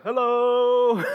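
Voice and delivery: a playful greeting through the door; dad popping into his son's class, sing-song hello; dad's sing-song hello. Retold or imitated.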